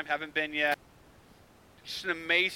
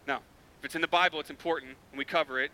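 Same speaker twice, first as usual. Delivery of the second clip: normal talking voice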